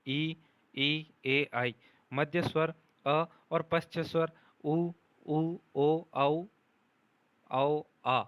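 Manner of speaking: teacher sounding ई ए आई उ ओ औ; vowels sounded out. Shown as a demonstration. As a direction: demonstrate the front, middle and back vowels ई अ उ. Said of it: "जैसे अग्र स्वर".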